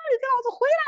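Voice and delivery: strained high yell, re-enacted at modest volume; mock panicked screaming from the sidelines; retold frantic yell. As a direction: imitate a frantic high-pitched holler. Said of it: "我在旁边疯狂的呐喊".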